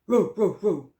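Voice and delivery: loud, punchy row row; mock barking. Narrator voicing the neighbourhood dogs, hammed-up barking. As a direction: bark the rows out like dogs.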